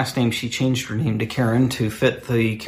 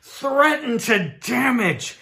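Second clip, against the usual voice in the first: a hint of a pompous spokesman, rising to higher pitch; mock corporate outrage; 6 semitones higher than usual. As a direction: play the indignant company rep. Reading Subway's statement in mock voice.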